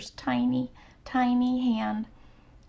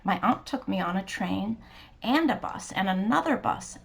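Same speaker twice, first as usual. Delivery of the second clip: plain reading voice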